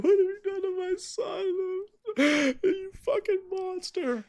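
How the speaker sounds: high, slow sobbing wail; hysterical sobbing mother. Acted. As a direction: cry it out at a monster, broken. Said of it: "just sobbing hysterically".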